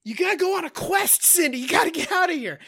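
high, fast, pleading orders; mock dying urgency. Shot dad sends Cindy on a quest, mock urgency. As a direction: imitate a wounded father rushing out his last words.

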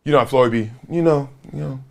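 low, laid-back Floyd impression; imitating Floyd. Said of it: "He said".

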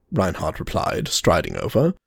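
ordinary delivery, nothing marked

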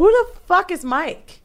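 high, drawn-out bewildered demand; retold disbelief. Storyteller learning the drink was shared, high-pitched disbelief. Retold shock at unknown Mike.

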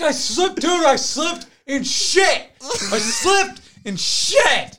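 high, slow drawn-out wail at full volume; screaming. Retold or imitated. Friend tells him what he slipped in, screaming. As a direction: scream it in horror, stretching the words. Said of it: "he starts screaming".

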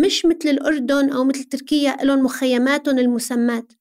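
ordinary talk

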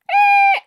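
put-on very high, loud baby squeal. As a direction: imitate a baby's shrill yell.

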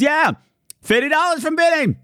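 high celebratory yeah; high excited cheer. Real, not acted. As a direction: cheer the fifty dollars. Celebrating donation amount.

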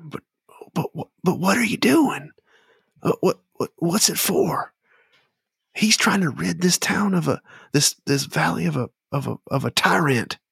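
high, stammering protest; flustered Despo. Acted. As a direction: sputter the objection in a high-pitched voice. Character argues back in held voice.

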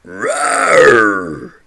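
deep roaring monster growl; beast growl. Acted. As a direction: roar it like a huge beast. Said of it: "It looks at Mark and growls".

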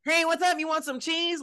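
nerdy Urkel voice. Retold or imitated. Friend mocking Urkel's nerdy pickup line, high goofy voice. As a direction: play the awkward nerd offering cheese. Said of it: "he was like".

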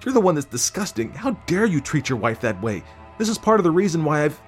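very high-pitched indignant wife; high, wounded outrage; outraged. A narrator's reading. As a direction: lash back, then break off suddenly. Wife lashes back, breaks off.

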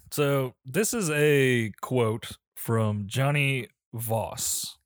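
ordinary talk

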